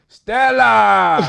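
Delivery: hammed-up Spanish accent, high and loud; classmate's Spanish-accented Stella shout; Spanish accent yell. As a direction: bellow the name in a heavy Spanish accent. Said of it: "the most Spanish accent".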